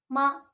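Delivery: मा short and falling sharply from high; fourth tone. Shown as a demonstration. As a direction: drop मा quickly from the top. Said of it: "इस तरह pronounce करेंगे". (मा छोटा, ऊपर से नीचे गिरता)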